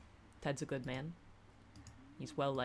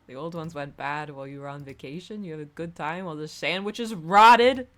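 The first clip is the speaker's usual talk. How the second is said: accusatory; 13 dB louder than usual. Acted. Bar regular blaming the one back from vacation, accusatory. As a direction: start even, then blow up into blame at the end. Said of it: "It's a bit accusatory".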